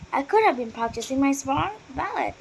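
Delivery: plain reading voice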